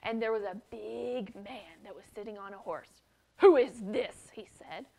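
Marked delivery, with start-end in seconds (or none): 3.4-4.3 demanding. Acted. Leader demands on horseback.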